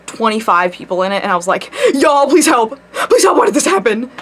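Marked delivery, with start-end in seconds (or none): 1.9-4.1 panicked plea. Retold or imitated. Pleading message to group chat.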